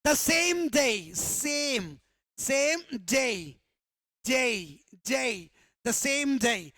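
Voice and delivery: same with a clear s, not d; pronunciation of same. Shown as a demonstration. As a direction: start same with a crisp s. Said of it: "स से शुरू हुआ". (सेम को साफ़ स से बोलना)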